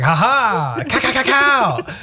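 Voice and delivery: high, slow mock snake call; snake call imitation. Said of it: "it makes that sound as well".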